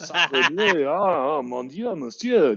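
high, slow, hammed-up French accent; mock French accent, pitched high and drawn out. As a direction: send up a smug French aristocrat in a French accent. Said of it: "the French act like".

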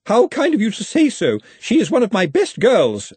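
forced politeness, high and loud; gracious words through barely held fury; high, strained housekeeper civility; spitting teeth. A narrator's reading. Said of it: "spitting teeth".

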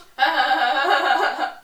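loud mock cackle; imitated big laugh. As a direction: mimic her huge burst of laughter. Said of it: "That was such a big laugh".

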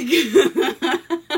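high burst of laughter. Real, not acted. Laughs at the elves joke.

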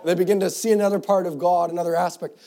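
preacher lifting the congregation; full-force preaching. Acted. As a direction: proclaim it to the whole church.

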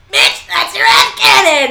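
loud, slow, exasperated retort; exasperated shout. Real, not acted. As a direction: call it out loudly in disbelief. Ranting at a fan's claim.

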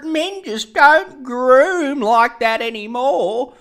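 put-on high, loud, slow gushing old lady; old grandma voice. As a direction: swoon over the TV host like a golden-age grandma. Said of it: "grandmas in Cronulla be like".

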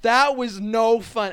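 put-on high, loud, slow TikTok kid voice; Evan Palmblad impression.

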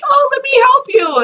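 high, sugary mock helpfulness; naive helper. Mocking the naive rescuer in Misery, high sugary offer. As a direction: coo it like an unsuspecting good neighbor. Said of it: "and you're like".

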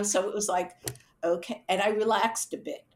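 ordinary delivery

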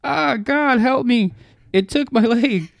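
high, loud pained shrieking; screaming in agony. Acted. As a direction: scream through horrible pain. Creature tears off her leg.

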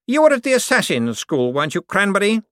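condescending lord to servant; Cosmo's voice. Acted. To his butler, Cosmo's lofty patronizing voice.

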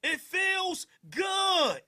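slow, drawn-out loud call; excited. Real, not acted. Streamer praising foreign music he loves, slow loud call. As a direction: stretch it out, loving it. Savoring the song loudly.

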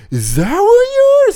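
very high, slow, loud squeak; drawn-out mock question. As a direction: stretch it out in a squeaky voice. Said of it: "He's like".

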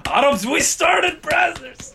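high, slow, proud declaration; proud boast. Real, not acted. Boasting to his friend about Arabs, high slow proud. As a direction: announce it like a proud victory.

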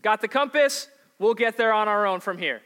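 high, loud, fast mock dismissal; mock breezy sailors. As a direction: toss off God like a tour guide. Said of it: "the sailors say".

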